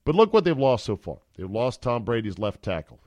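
ordinary delivery

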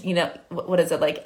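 ordinary delivery

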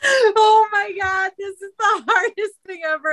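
breathless laughter; real laughter; laughing hard. Real, not acted. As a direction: laugh through the words. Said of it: "I'm laughing so hard".

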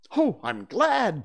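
high, loud, drawn-out relief; heartfelt, lifted exclamation; glad cry. Acted. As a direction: cry out slowly with delight. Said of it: "Peter cried".

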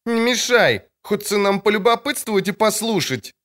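a lightly voiced crowd woman; market woman. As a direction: tell the girl to stop interrupting. Trader woman continues.

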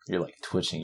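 ordinary delivery

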